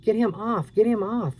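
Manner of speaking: high panicked pleading; panicked scream. A narrator's reading. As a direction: beg in terror, pitched high. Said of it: "she screamed".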